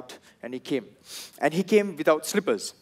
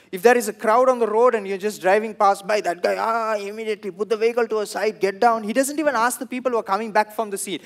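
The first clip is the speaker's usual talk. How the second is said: ordinary talk, nothing marked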